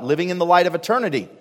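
usual speaking voice

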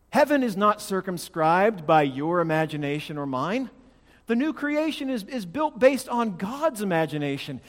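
high, loud pulpit insistence; ringing, emphatic sermon peak; impassioned preaching. Real, not acted. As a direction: proclaim it with full force. Preaches at full force.

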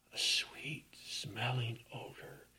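"sweet-smelling odor" in a soft breathy hush; whispered. Acted. Hushed awe over sweet odor.

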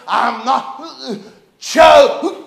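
imitating words broken by heaves; between heaves. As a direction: gasp it out in bursts, as if squeezed. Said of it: "in between heaves".

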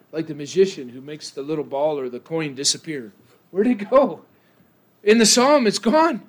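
usual speaking voice

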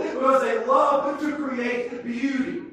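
loud, high, building sermon cry; preaching at full force. Acted. Preacher lifting the congregation, loud and soaring.

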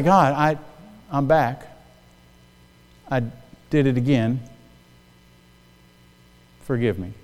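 soft, humble prayer to God, barely voiced; hushed confession. Preacher voicing his own prayer, hushed and contrite. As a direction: whisper it like a sinner coming back.

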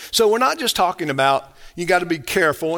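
ordinary talk, nothing marked

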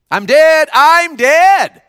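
high, loud shout; loud group cry of I'm dead. Acted. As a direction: yell it out together. Kids shout the lesson phrase.

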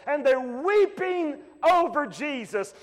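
ordinary talk

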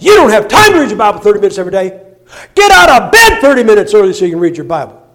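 "You don't have time to read your Bible thirty minutes every day" shouted loud and fast; pulpit shout. Retold or imitated. Pastor rebukes the congregation from the pulpit: loud fast shout. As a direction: blast it at the congregation. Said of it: "if that ain't cussing in the pulpit".

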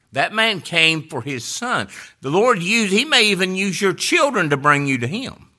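loud, high, driving sermon cry; preaching at full force. Acted. Pastor pressing the congregation toward faith, loud preaching. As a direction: lift the whole room.

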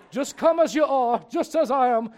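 a hint of Billy Graham; Billy Graham invitation. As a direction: call them forward like an old crusade preacher. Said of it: "just like Billy Graham back in the day".

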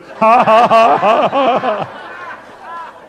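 loud, high imitated whoop of laughter; victorious laugh. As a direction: burst out in her triumphant cackling laugh. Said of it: "She was shouting a victory".

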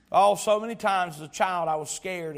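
usual speaking voice

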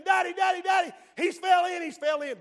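put-on a boy screaming for help; panicked call for daddy. Boy screams for his father.

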